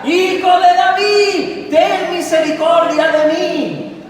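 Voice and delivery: high, drawn-out shouted plea; desperate beggar's cry, loud and sustained. Acted. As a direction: bellow each word slowly to be heard. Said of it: "clamaba mucho más".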